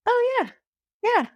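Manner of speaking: high, sudden oh yeah; mock realization. Ranchers suddenly agreeing with the speaker, high mock realization. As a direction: imitate the room suddenly getting it.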